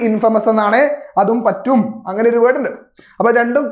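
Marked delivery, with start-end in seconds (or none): 0.1-0.5 ഇൻഫമസ് with a short "fa", no "fay"; correct pronunciation. Shown as a demonstration. Teacher explains how the prefixed word is read, ഇൻഫമസ് with short "fa". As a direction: say ഇൻഫമസ് as IN-fuh-mus. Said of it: "വായിക്കുന്നത് ഇൻഫമസ്".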